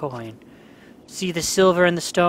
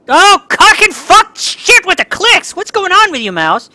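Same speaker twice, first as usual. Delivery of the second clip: much higher, loud and slow raging curse; real rage at the clicking mouse; furious yelling; 12 dB louder, slower than usual. Real, not acted. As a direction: scream at the broken mouse. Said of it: "I'm getting so angry".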